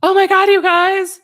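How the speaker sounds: high thrilled imitation of a giddy text; mock excited news. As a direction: brag to friends with glee. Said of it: "if I texted my friends, like".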